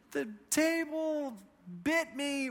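put-on high, soft, slow little-boy whimper; imitated hurt child. Hurt son blaming the table to dad, mock whimper.

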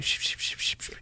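soft, voiceless shushes; repeated shushing. Acted. Suitor shushing woman in court, soft hissed shushes. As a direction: hush someone with a string of quick shushes.

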